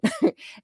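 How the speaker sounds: short high laugh; a quick disbelieving laugh; real laugh. Real, not acted. Laughs at ex's claim.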